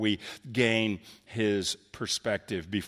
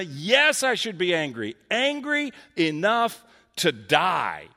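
defiant anger; 7 semitones higher, 5 dB louder than usual. Retold or imitated. Preacher voicing defiant Jonah.

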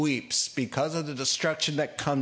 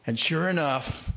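ordinary delivery

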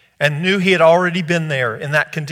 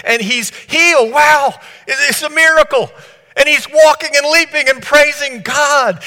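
rising, louder, thrilled telling of the miracle; loud joyful build in a preacher's voice; excited preaching; 8 semitones higher, 5 dB louder than usual. Acted. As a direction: lift the room with the wonder of it.

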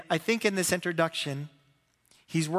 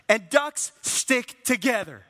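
higher, louder, slow and deliberate coach rally; 5 semitones higher, 5 dB louder, much slower than usual. Retold or imitated. Coach rallies his losing team, slow rallying pep talk. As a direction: lean into every word like a pep talk.

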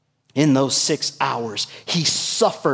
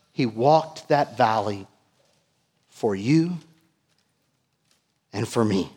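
ordinary delivery

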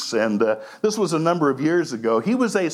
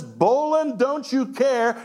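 wife's indictment; 6 semitones higher than usual. Retold or imitated. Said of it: "she's not asking a question".

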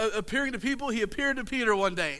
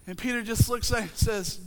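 normal talking voice

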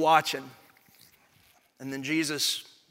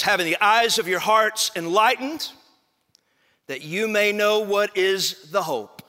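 raised, ringing proclamation of the verse; emphatic scripture reading; 6 semitones higher, 8 dB louder than usual. Acted. Preacher reads Paul's letter to the congregation, proclaiming. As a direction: read it out loud and high.

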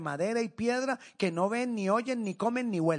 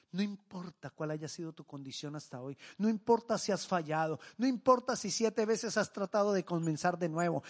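usual speaking voice

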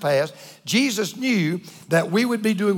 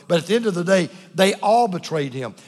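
ordinary talk, nothing marked

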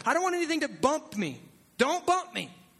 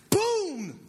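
boom; 7 semitones higher than usual. Real, not acted. Preacher stressing God's call.

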